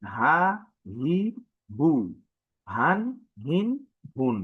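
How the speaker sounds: each syllable said short and separate; Arabic vowel drill. Shown as a demonstration. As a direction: model each sound for the class, crisp. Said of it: "আমি আবারও বলি".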